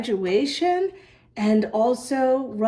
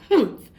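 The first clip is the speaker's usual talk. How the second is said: indignant humph; 6 semitones higher, 5 dB louder than usual. Acted. Stacy turns away offended.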